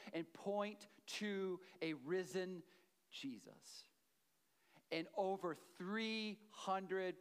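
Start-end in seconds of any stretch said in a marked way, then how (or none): 3.1-3.8 breathy hushed whisper of Jesus; whispered name. Real, not acted. Sermon climax, whispered name.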